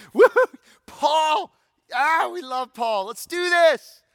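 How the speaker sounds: high, loud cheer for Paul; excited cheering. Retold or imitated. Congregation cheering for Paul's letter, loud and high. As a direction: whoop it up like a fan at a game.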